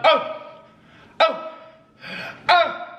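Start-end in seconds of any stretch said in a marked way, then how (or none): none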